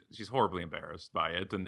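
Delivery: normal talking voice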